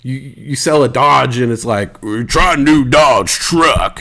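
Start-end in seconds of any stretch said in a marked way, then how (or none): 2.3-4.0 mock folksy truck commercial pitch; American truck ad voice. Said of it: "that's an American accent".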